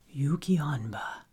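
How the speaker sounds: low, breathless whisper of a feared name; dread-filled whisper. Acted. Abbot whispers the name to Haru, fearful whisper. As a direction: barely dare to say her name. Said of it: "The abbot's whisper came threaded with dread".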